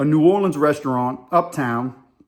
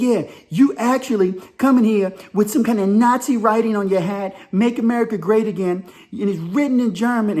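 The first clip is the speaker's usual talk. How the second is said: higher, mock-outraged accusation; imitated indignant woman; 6 semitones higher than usual. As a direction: pile on the accusations, mimicking her outrage. Acts woman accusing him.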